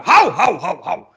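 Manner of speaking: high, loud startled barks falling away; surprised dog. Retold or imitated. Comedian imitates the Soweto dogs barking in surprise. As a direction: bark in shock at the passersby. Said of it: "They would go".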